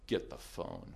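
low, quiet and cold; quiet stern order. Retold or imitated. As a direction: say it quietly, with a hard stare. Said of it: "he just glared at me".

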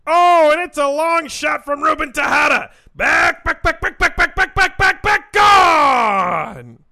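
shouted, high play-by-play call; home run call. Acted. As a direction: belt out the home run like Chris Berman. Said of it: "The way he said "back".

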